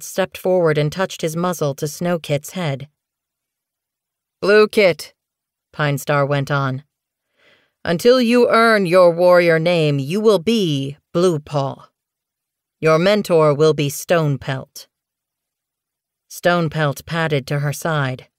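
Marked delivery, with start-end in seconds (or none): 4.4-5.1 louder, ringing summons; calling a name. Acted. Clan leader summoning Bluekit before the clan, ringing call. Leader calls kit forward. 7.8-14.9 ceremonial proclamation. Acted. Apprentice ceremony speech.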